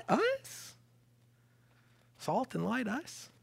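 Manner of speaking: high squeaky incredulous questions with laughs; preacher voicing the congregation doubting themselves, incredulous; mock disbelief. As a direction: play up comic disbelief.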